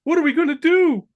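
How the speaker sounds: high, loud frantic question; frantic high cry; panicked. Acted. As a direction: beg for a plan in panic. Freddy panicking in script.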